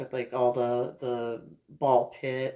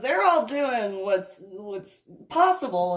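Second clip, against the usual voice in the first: usual speaking voice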